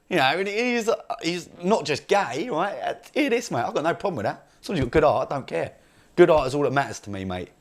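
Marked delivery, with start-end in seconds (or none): none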